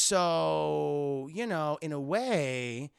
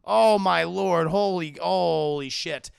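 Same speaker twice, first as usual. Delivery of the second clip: loud, slow, drawn-out disbelief; mock shock; 9 dB louder, slower than usual. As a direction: stretch each word in stunned shock. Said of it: "He can't believe it".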